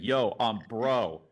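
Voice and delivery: lightly hammed-up "yo bro". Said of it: "Strength of intro".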